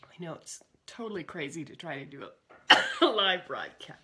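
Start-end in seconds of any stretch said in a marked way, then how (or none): 2.3-3.0 sharp loud cough. Real, not acted. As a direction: cough mid-sentence. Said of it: "Who knows where this cough came from?".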